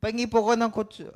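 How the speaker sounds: high, loud put-on voice of a foreigner ordering; mock foreign customer. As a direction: play the confused customer ordering loudly. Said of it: "nag-order po sila eh". (malakas at mataas na boses ng dayuhang customer)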